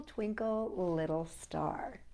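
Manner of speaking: usual speaking voice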